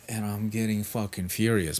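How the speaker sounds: ordinary talk, nothing marked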